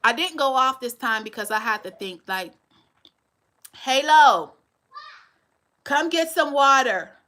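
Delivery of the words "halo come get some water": calling out. Real, not acted. Calling her child from another room.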